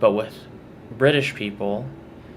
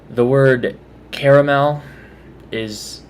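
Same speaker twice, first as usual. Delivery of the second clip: ordinary delivery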